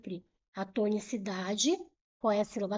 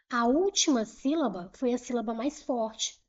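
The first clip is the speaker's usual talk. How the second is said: normal talking voice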